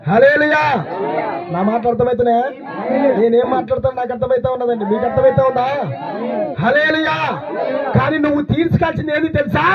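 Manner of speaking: high, insistent pulpit call; rousing preaching. Acted. Preacher checking the congregation understands, high and rousing.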